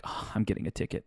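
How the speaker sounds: whispered dread; panicked whisper. Retold or imitated. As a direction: breathe it in quiet panic. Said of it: "I'm freaking out".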